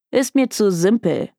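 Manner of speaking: bored brush-off ending on a fall; dismissive. A narrator's reading. Detective turning down a client's case, dismissive. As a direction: wave the case away as beneath you.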